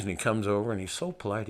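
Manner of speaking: ordinary delivery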